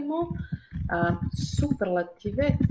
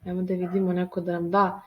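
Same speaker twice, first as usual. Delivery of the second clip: ordinary talk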